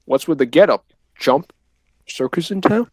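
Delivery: loud mocking henchman taunt; Spider-Man goon. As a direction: jeer like a comic-book thug. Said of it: "Spider-Man goon ... the way he goes".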